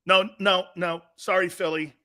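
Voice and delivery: ordinary talk, nothing marked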